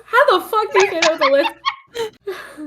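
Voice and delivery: slowed, laughing complaint. Real, not acted. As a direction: laugh through the frustration. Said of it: "It's hard to say with a lisp".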